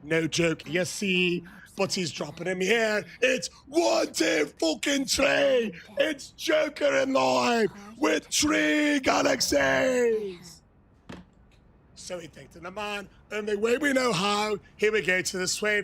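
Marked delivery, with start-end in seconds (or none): 3.2-10.6 high slow shout counting; hyped slow count; excited count. Real, not acted. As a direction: count it up with pure hype. Hyping gift count.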